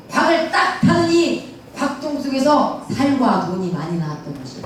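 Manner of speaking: 살 for 쌀 in a Gyeongsang mouth; Gyeongsang accent. Retold or imitated. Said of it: "쌀 발음이 안 되잖아요".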